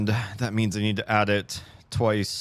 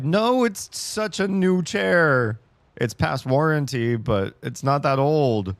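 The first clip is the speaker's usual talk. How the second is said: high, loud, slow whine; whiny lament; 8 semitones higher, 5 dB louder, slower than usual. Real, not acted. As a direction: drag out the disbelief. Hydraulics dying, lamenting.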